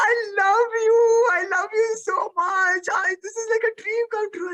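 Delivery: very high, gushing fan voice; hammed-up tearful adoration; imitated overwhelmed girl. Small girl to her favourite actor, imitated tearful gushing. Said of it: "with tears in her eyes".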